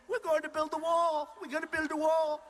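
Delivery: lightly mocking Marco Rubio impression; mocking little Marco. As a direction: parody a small, eager Marco. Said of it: "little Marco, he said".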